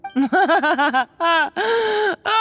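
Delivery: breathless high villain laugh; a second burst of mocking laughter. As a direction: laugh at her, high and gleeful.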